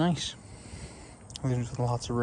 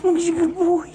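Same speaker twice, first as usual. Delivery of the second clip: squeaky praising a dog; 7 dB louder than usual. Real, not acted.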